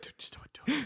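voiceless whisper, pushing hard; whisper urging. Acted. Egging someone on in a secret, voiceless whisper. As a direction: hiss the urging under breath.

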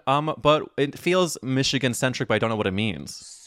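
normal talking voice

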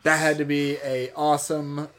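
ordinary talk, nothing marked

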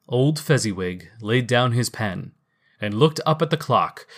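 plain reading voice

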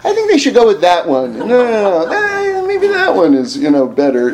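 high-pitched mock neighbors debating, breaking into laughs; hammed-up neighborly second-guessing; fussy neighbors' chatter. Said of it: "neighbors congregating around the house".

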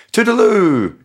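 high, loud mock whoop; playful call while racing downhill; whooping Toodaloo. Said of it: "just going".

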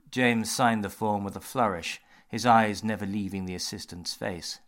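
plain reading voice

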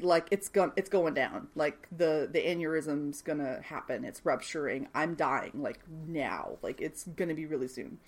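low, soft retold dread; hushed grim realisation. Acted character realising she is dying.

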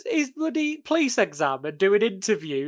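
loud, high rant breaking into laughter; real laughter bubbling through the words; laughing incredulously. Real, not acted. Incredulous joke told through laughter.

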